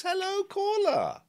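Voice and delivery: high, slow radio-host greeting; hello caller. Acted. Host greeting a caller, high and drawn out. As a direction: sing out to the caller like a cheesy DJ. Host answering a phone call.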